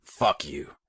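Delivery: firm, strong retort; defiant. A narrator's reading. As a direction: say it with proud defiance. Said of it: "strength and defiance in his voice".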